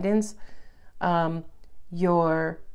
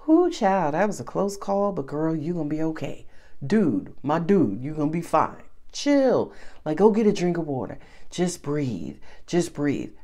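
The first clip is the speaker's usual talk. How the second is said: soothing reassurance; 5 semitones lower than usual. Retold or imitated. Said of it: "the nervous system starts to say".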